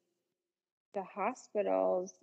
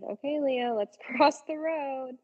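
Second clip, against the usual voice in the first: coaxing a dog; 7 semitones higher, 4 dB louder than usual. Retold or imitated. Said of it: "I'm like".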